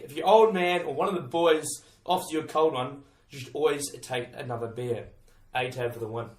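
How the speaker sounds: ordinary talk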